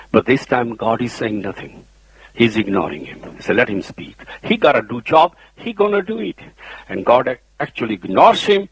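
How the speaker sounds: ordinary delivery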